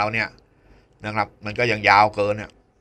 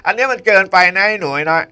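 teasing scold; 9 semitones higher, 6 dB louder than usual. Acted. Host teasing students about long hair.

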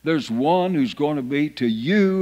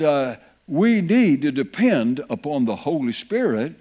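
ordinary talk

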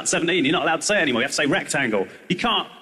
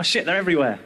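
ordinary talk, nothing marked

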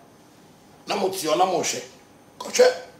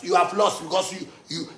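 ordinary delivery

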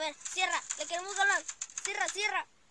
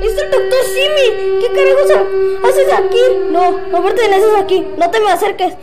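high loud startled outcry; alarmed shout; 4 semitones higher, 7 dB louder than usual. Real, not acted. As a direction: react in shock. Alarmed at surprise character. (grito de sorpresa alarmada)

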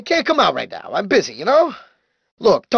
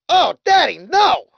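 alarmed cry; 5 semitones higher, 3 dB louder than usual. Acted. Cries out in alarm during ride.

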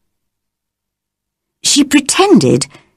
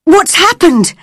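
much higher, alarmed question; wild cry; 10 semitones higher than usual. A narrator's reading. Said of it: "cried Mary's mother wildly".

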